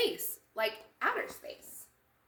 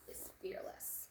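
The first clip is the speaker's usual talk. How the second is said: soft unvoiced whisper; 13 dB quieter than usual. Cookie praising Milk to the kids, whispered. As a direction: breathe it like a secret.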